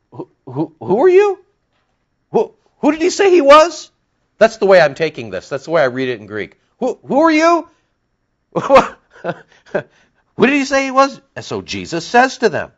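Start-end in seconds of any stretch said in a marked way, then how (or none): 0.1-3.9 high, loud bewildered questioning; mock the leaders' baffled demand. 6.8-11.2 high, loud bewildered questioning; mock the leaders' baffled demand.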